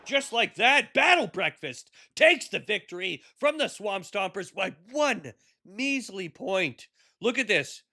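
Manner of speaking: hyped live play-by-play call; sports announcer. Acted. Calling the final plays.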